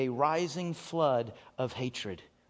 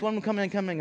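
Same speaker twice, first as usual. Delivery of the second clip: ordinary talk, nothing marked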